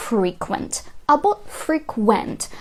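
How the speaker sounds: "frequent" stressed on FRE, then "frequent" stressed on QUENT; two stresses contrasted. Shown as a demonstration. Teacher showing two accents of one word, stress contrast. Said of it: "куди ви поставите наголос".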